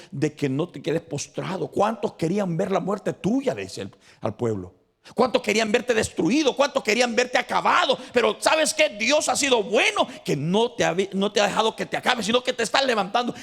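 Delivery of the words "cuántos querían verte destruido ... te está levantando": high, loud fervent preaching; rally the congregation, voice lifted; preaching at full force. Acted. Preacher rallying the congregation, high and loud fervent call.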